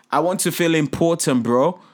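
ordinary delivery

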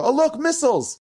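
put-on high, thrilled kid's cry; excited child. Little kids see missiles at the Shabbat meal, excited cry. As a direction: squeal it like an amazed little kid.

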